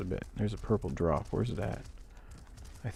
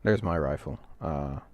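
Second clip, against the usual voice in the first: normal talking voice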